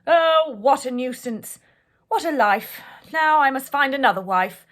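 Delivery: loud, hammed-up royal wail; mock lament. A narrator's reading. The king loudly bemoaning his late wife. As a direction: moan it like a put-upon king. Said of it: "up and cried".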